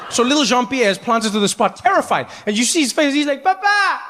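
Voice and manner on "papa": put-on high scared kid crying Papa; scared child calling. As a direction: call out like a frightened kid. Child calling his father.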